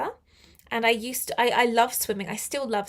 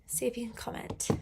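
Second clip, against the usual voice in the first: whisper; 10 dB quieter, faster than usual. Real, not acted. Trailing whisper to viewers.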